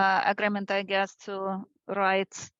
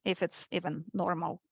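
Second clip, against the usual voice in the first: normal talking voice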